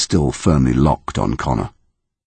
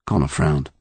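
plain reading voice

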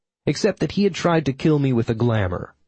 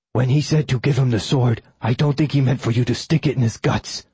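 lightly voiced companion figure, a slightly different timbre; a hint of a character voice, lightly put on; Oberon's character voice. Oberon remarks on the sword in the guts, lightly voiced character.

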